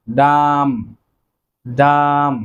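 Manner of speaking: ডাম said slowly and clearly, with the final b silent; careful pronunciation. Shown as a demonstration. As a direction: model the word ডাম for the class. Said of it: "বলা হয়".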